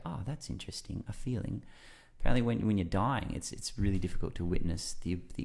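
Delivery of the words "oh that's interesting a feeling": soft, calm observing voice; note it calmly, softly; calm detached. Retold or imitated. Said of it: "just go".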